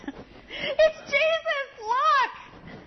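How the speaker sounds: put-on high, slow, near-tearful child's cry; overwhelmed little girl; thrilled child. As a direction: call out in awe like a small girl. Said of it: "screaming his name".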